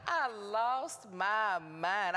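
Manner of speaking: drawn-out, hammed-up sob; mock crying. Wife re-enacting her meltdown over dinner, in an exaggerated crying wail. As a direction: wail it very slowly, as a comic breakdown. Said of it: "I cried all the way down those stairs".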